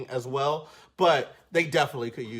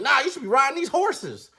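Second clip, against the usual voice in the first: higher, louder dismissive dad; stubborn dad; 10 semitones higher, 4 dB louder than usual. Retold or imitated. Dad telling his smart kid to ride horses, higher and louder. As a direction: brush the kid off firmly. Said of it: "He's like".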